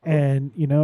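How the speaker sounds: normal talking voice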